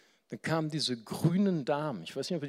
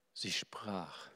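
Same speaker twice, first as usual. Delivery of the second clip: whisper; 4 semitones lower, 8 dB quieter than usual. Shown as a demonstration. Preacher quoting the woman, now whispered. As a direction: repeat it in a hushed whisper.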